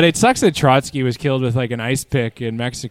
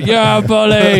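loud, slow, high-pitched yell; weird yell; 8 semitones higher, 6 dB louder, slower than usual. Retold or imitated. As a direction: belt it out in a strange voice. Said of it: "I just yelled some weird".

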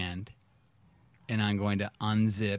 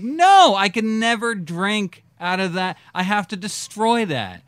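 much higher and louder, indignant; outraged shout; 10 semitones higher, 8 dB louder than usual. Retold or imitated. Swearing off the fouled jug in a high outraged shout. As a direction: blow up at the ruined jug.

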